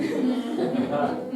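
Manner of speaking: a quick amused laugh; short laugh. Real, not acted. Speaker laughs at being the odd Baptist out. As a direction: laugh it off lightly.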